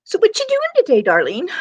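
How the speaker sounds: lilting high darling; sing-song greeting. Real, not acted. Host greeting her friend, sing-song and high.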